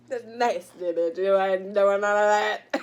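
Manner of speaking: loud retold scolding; mock telling-off. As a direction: raise your voice to scold, retelling it.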